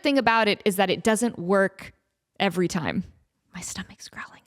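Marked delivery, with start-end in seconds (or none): 3.5-4.4 hushed unvoiced aside; a soft whispered confession. Real, not acted. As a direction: whisper it as if embarrassed. Aside about her hungry stomach.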